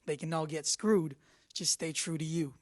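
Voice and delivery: ordinary delivery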